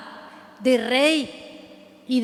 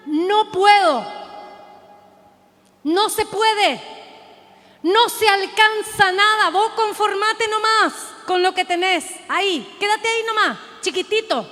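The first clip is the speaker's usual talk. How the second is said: high, loud mocking cry of the enemy; the enemy shouting you down, high and loud; world shouting; 6 semitones higher, 5 dB louder than usual. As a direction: yell the taunts like a sneering tormentor. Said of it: "El mundo te grita".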